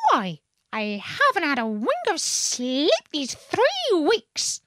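high, slow put-upon wail; wailing complaint. A narrator's reading. Pigeon telling Alice of sleepless weeks, wailing. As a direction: stretch out the grievance up high.

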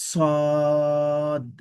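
emphatic Arabic sad, full hiss. Shown as a demonstration. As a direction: hiss the স in সদ heavily. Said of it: "একটু শীষ হবে".

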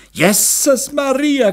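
high, drawn-out shocked cry; screeching. A narrator's reading. Mother throws up her hands at her husband, screeching. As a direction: shriek it slowly, scandalised. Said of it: "kreischte die Meisterin".

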